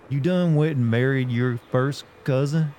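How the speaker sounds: slow, hammed-up southern drawl. Shown as a demonstration. Streamer doing chat's cousin request, exaggerated southern accent. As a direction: lay the southern twang on thick. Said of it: "up the southern levels of it".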